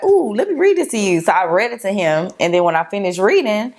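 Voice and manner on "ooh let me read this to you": high, eager ooh; bubbly invitation to listen; lightly acted eagerness; excited quote. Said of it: "I was like".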